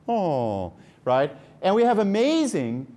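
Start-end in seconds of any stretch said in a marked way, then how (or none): 0.1-0.7 drawn-out falling "oh" sigh; compassionate sigh. Shown as a demonstration. Researcher demonstrating the sound people make for compassion, sighing. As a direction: sigh out a sympathetic "oh". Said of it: "It sounds like a sigh".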